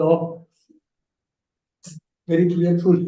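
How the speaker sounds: ordinary delivery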